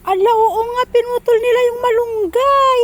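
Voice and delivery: real shocked outcry; dismayed exclamation. Real, not acted. Finds the malunggay was cut down.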